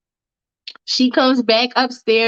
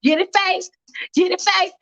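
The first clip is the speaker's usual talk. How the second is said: higher, cheering her friend on; Monique hyping; 7 semitones higher than usual. Retold or imitated. Monique cheering Faith's dance, higher and hyped. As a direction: hype girl egging her on. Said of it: "Monique is like".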